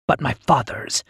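usual speaking voice

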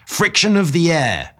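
high, loud shout of the words. A narrator's reading. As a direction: shout it so he finally hears. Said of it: "I shouted".

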